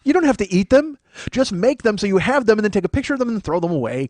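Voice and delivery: usual speaking voice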